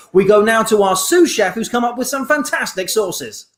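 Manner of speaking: bright TV presenter voice; shopping channel host. Acted. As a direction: sell it like a TV host.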